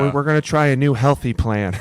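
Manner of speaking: hint of a cheery dietitian; lightly mock staff announcement. Quoting staff announcing healthy diet.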